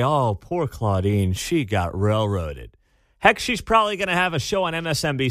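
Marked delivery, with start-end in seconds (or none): 0.0-2.8 mock pity. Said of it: "her friends in the media to say".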